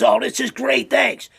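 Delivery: mock-delighted and grateful; delighted thanks. Grateful guest accepting the farmer's offer.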